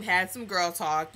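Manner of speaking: ordinary talk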